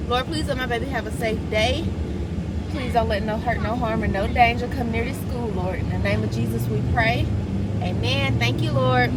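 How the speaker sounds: a lightly voiced prayer for her son. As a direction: pray gently over the school day. Prays for son before school.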